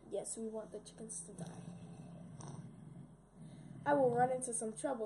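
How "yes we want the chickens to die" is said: slow, scheming whisper; evil whisper. Acted. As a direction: gloat quietly over the plan. Scheming aside after laughing.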